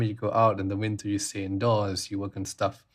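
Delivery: normal talking voice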